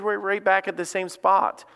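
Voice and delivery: ordinary talk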